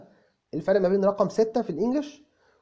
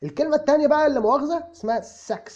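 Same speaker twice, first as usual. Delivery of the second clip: normal talking voice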